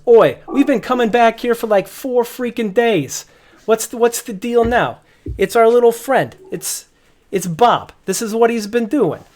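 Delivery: high, loud, indignant guard captain; angry head guard. Acted. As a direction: bark it up high like a fed-up guard boss. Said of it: "the head guy turns and goes".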